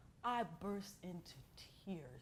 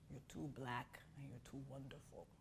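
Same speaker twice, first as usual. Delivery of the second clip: gentle grandfather; 6 semitones lower, 9 dB quieter than usual. Retold or imitated. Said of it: "he said".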